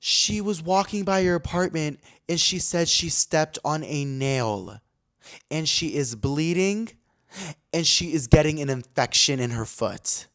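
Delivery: slow, drawn-out grave report; imitated bad-news report, slow and deliberate; dramatic retelling. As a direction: stretch each detail out for dramatic weight. Manager's report, repeated verbatim, drawn out.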